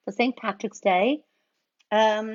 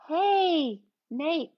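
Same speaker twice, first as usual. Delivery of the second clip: normal talking voice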